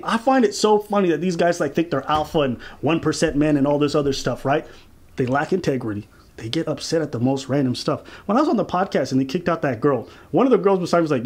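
normal talking voice

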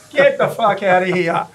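loud, slow, laughing get outta here; laughing disbelief. Retold or imitated. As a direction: drag it out in happy disbelief. Tony's delighted disbelief.

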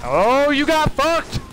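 slow, rising crow of triumph; gloating cry. Real, not acted. Gamer taunting a player he just beat, gloating cry. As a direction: stretch the "Oh" and swoop up high in real glee.

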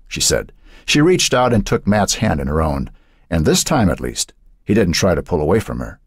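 ordinary delivery, nothing marked

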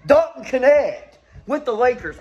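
excited. Real, not acted. Repeating the draft news in disbelief.

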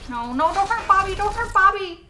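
loud, high pleading cry; panicked shout. Real, not acted. Viewer shouting at the show to save Bobby, panicked. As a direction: beg the screen to spare her.

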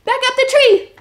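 high, loud breathless running chant; being chased. Acted. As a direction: race home in a panic. Running back from dragon.

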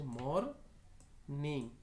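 MORNING split as মর, pause, নিং; syllable by syllable. Shown as a demonstration. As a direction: say মরনিং slowly in two separate parts. Word built from two syllables.

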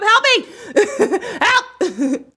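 laughing high plea for help; half-laughing help me; laughing cry for help. Real, not acted. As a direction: giggle out a mock call for help. Laughing at settings trouble.

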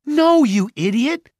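high, loud exasperated protest; piercing alarmed shout. A narrator's reading. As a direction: yell to stop a foolish move. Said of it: "Mallory shouted".

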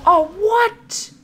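high, loud startled cry. Real, not acted. Player bitten by a doxy, startled cry. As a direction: caught off guard by the bite.

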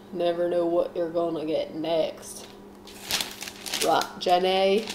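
normal talking voice

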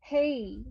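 হেই gliding clearly into i; correct pronunciation. Shown as a demonstration. Teacher gives the correct way to say hay. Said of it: "প্রনাউন্সিয়েশনটা কেমন হবে".

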